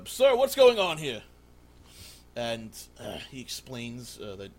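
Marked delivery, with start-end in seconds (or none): 0.1-1.2 loud, high urgent question; alarmed guard. Acted. Guard questioning the man in the room, alarmed. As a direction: demand an explanation as the guard.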